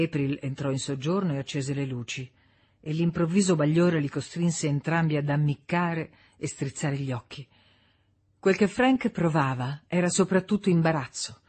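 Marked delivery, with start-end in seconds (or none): none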